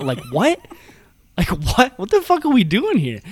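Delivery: mock-indignant, rising questions; imitating pissed-off disbelief. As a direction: act out her exasperation, climbing in pitch. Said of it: "she was fucking upside down pissed".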